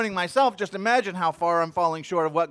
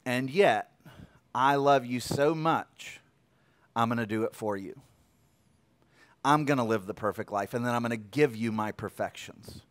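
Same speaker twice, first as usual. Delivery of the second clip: tender voice of God; 6 semitones lower, 5 dB quieter than usual. Acted. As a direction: speak softly, like God comforting a failing child. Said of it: "God comes and says".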